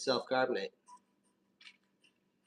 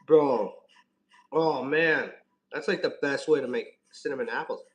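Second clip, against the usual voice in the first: frat bro; 8 dB louder than usual. Real, not acted. Tasting apple, excited exclamations.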